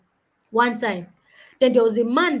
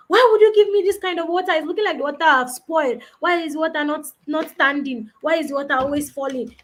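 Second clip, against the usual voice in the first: fast, high, loud complaint that fades down; retold angry shout; 5 semitones higher, 4 dB louder than usual. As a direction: yell like an upset diner at the waiter. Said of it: "The man started shouting".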